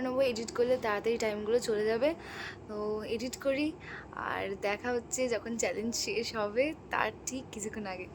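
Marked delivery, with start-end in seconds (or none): none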